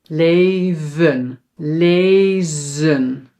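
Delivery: LEVEN and LEZEN with the last E as a weak uh; schwa ending. Shown as a demonstration. Teacher shows unstressed syllables in Dutch, slow schwa endings. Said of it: "Then we pronounce it as e".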